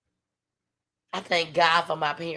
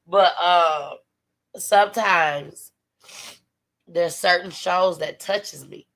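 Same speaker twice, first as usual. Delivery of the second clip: normal talking voice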